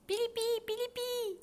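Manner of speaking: very high whistled lark call; lark whistle. Acted. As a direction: whistle it like a skylark. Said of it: "siffle l'alouette". (sifflement aigu d'alouette)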